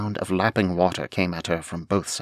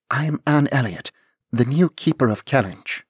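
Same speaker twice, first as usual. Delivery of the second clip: higher, louder declaration; bold young lady; 6 semitones higher, 4 dB louder than usual. Acted. Anne declaring herself to the dragon, bold and high. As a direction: announce yourself to the beast.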